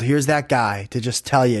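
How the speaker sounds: usual speaking voice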